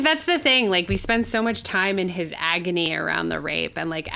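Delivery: usual speaking voice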